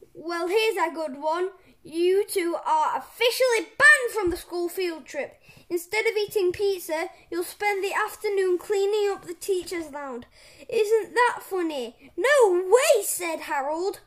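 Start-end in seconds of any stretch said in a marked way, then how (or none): 0.1-12.1 loud, hectoring announcement of punishment; gloating principal. A narrator's reading. Principal gleefully punishes the boys. 12.2-13.0 high, loud objection; indignant protest. A narrator's reading. Boy protests the punishment.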